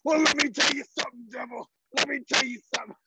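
high, fast wrestler promo; wrestling promo voice. Pretend. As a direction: cut a wrestling promo at the devil. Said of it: "check this out, this promo".

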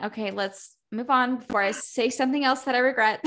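ordinary talk

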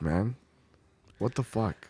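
usual speaking voice

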